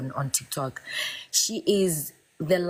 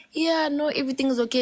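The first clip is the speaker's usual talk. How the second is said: mock-casual mom voice, higher; mom reassuring; 8 semitones higher, faster than usual. Mom calming her daughter at midnight, imitated higher. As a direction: brush it off lightly like mom did. Mom's late-night text voiced.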